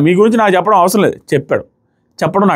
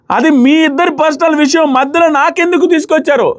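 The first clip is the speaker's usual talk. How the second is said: mock shouted protest, pitched up; retold yell, higher; 7 semitones higher than usual. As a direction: imitate him yelling back, half-hearted. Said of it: "Sai గట్టిగా అరిచాడు". (అనుకరిస్తూ ఎత్తైన స్వరంలో అరుపు)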